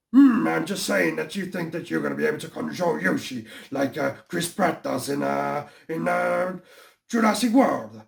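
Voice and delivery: put-on villain voice, doubting and mocking; skeptical cartoon villain. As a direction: needle the hero in a villain's voice.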